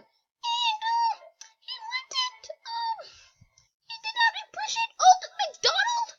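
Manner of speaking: very high falsetto chick; tiny squeaky chick explaining haltingly; little chick. Pretend. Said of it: "And little chick, what you gotta say".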